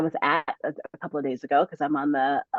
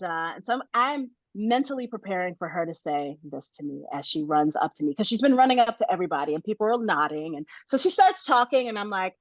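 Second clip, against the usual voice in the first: ordinary delivery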